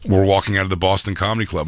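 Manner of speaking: normal talking voice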